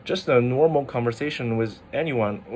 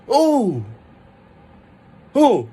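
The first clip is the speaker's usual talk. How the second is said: loud high "Oh" that falls sharply; acted shock, as a fourth tone; 10 semitones higher, 6 dB louder than usual. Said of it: "you're kinda shocked".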